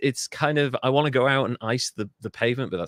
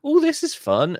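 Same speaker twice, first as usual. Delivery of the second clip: high, bright-pitched happy dog voice, louder; excited dog; 6 dB louder than usual. Retold or imitated. Owner voices the dog's joy at fresh snow, excited. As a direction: voice a delighted dog. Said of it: "my dog's like".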